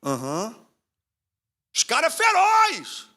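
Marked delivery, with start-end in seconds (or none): 1.7-3.1 high, loud alarmed call; panicked warning. Retold or imitated. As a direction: warn him in a rush of fear. Fleeing people warn Samar.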